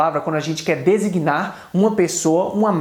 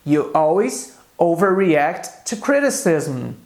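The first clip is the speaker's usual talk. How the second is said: slow, clear English example read for students; slow model sentence; slower than usual. Shown as a demonstration. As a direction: say each word slowly for the class. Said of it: "Então eu posso dizer em inglês". (frase de exemplo lenta e clara)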